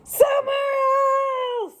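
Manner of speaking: fed-up elephant shout; angry shout. Acted. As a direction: yell it in frustration. Gerald angry with birds.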